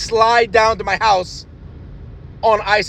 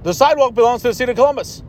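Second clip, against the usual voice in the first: ordinary delivery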